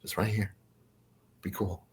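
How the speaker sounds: very soft, low reassurance; hushed, imitated handler voice; tall gray guiding. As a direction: steer someone quietly, keeping them cool. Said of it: "he's kinda guiding me".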